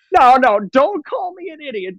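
high whiny mock protest; mock crying and screaming. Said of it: "they're crying, screaming".

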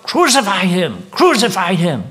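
high, loud mob cry of crucify; angry crowd demanding crucifixion, loud shouts; crowd shouting. Retold or imitated. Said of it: "were screaming out".

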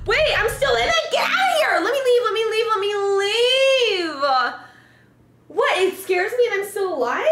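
high, loud frantic panic; scared horror game streamer. Real, not acted. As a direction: beg to get out.

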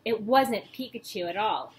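ordinary delivery, nothing marked

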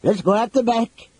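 high, urgent put-on voice; urgent character voice. A narrator's reading. Flint to companions as horns blast, urging escape.

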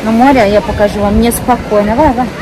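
ordinary talk, nothing marked

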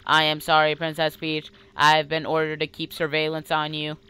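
monotone machine explaining itself; computer voice. Acted. Computer apologizing to the princess for surveillance, monotone. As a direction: speak on one level pitch. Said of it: "I'll do the voice".

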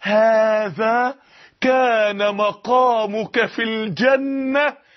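parola per parola, lentissimo, con voce solenne e distante; voice of the grave's verdict. Retold or imitated. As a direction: pronuncia "هذا" trascinata, come una sentenza dall'aldilà. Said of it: "ثم يقال له".

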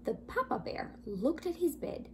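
usual speaking voice

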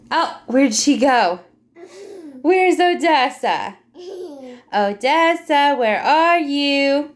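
slow, high playful search voice; playful sing-song. Real, not acted. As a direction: play hide and seek, drawn out. Hide and seek with toddler.

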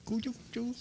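high, soft sing-song teasing. Real, not acted. Preacher teasing a grinning baby in a high coo. Cheering the child on.

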